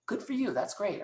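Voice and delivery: a friend hears your news and cheers you on; happy for you. Retold or imitated. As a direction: play the pleased friend. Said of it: "they are always happy for you".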